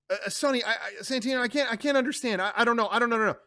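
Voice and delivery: Mama Corleone handing Sonny the phone, flustered. Acted. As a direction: fumble for words.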